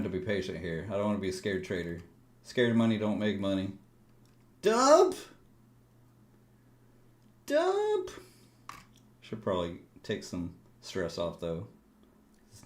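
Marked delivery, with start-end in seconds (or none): 4.6-8.1 very high, rising outburst; frustrated yell. Real, not acted. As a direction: yell at the chart. Yelling at chart.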